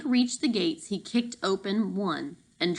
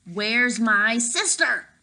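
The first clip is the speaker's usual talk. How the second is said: louder, slow, pressing demand; urgent demand; 4 semitones higher, 5 dB louder, slower than usual. Acted. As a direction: plant each word, demanding an answer. Boy demands his sister.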